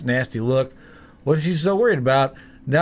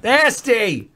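disgusted; 9 semitones higher, 4 dB louder than usual. Real, not acted. Sticky shot, disgusted.

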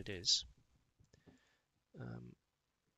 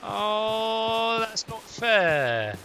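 loud, drawn-out, slow wail; whiny complaint; 8 semitones higher, 13 dB louder, much slower than usual. Real, not acted. Player moaning after an unfair death, slow loud whine. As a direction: moan at the unfairness.

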